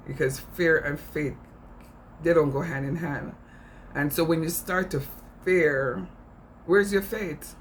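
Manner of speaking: ordinary talk, nothing marked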